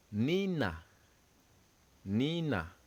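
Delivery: "Nina" said nee-nah; French word drill. Shown as a demonstration. Teacher models the French word for students. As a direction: model "Nina" clearly for the class.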